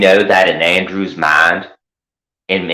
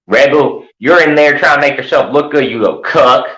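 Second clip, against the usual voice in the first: higher and louder, jabbing at him; raised voice scolding a rival; angry call-out; 7 semitones higher, 4 dB louder than usual. Real, not acted. Calling out Rebel directly.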